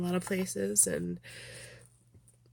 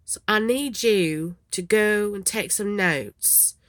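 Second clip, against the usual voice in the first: slow, put-on Texas drawl; bad Texas accent; 2 semitones higher, 3 dB louder, slower than usual. Shown as a demonstration. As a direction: stretch it into a fake Texas accent. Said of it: "that's my really bad Texas accent".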